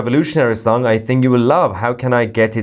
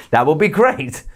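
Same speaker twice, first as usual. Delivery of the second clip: ordinary talk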